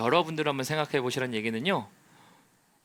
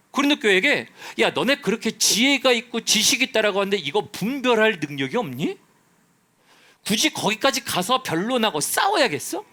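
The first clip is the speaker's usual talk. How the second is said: higher, louder impassioned sermon; full-force preaching; 8 semitones higher, 7 dB louder than usual. Acted. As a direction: preach it hard to the congregation. (높고 큰 목소리로 열정적인 설교)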